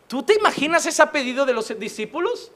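normal talking voice

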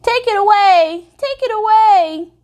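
put-on high, loud slow child's plea; a terrified little girl pushing it away; panicked child. A narrator's reading. Little girl begging the narrator to take the moose, high panic. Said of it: "she said".

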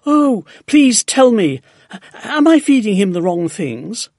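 loud, high, anxious begging; pleading cry. A narrator's reading. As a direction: beg desperately to be told. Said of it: "she cried".

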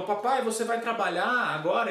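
an eager daughter, lightly acted; little daughter. Daughter voiced in a story.

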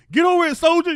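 high, booming sergeant-style yell; military drill shout. Acted. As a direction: bellow it at a balking recruit. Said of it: "that wall is like a military wall".

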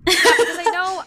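loud laughing burst; laughing as she starts. Real, not acted. Laughing outburst.

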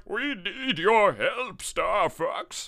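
high, very slow plea, stretched out; General Pepper. Acted. General calling on Star Fox for help, drawn-out impression. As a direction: draw out each word in a put-on general's voice. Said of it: "Like, General Pepper's like".

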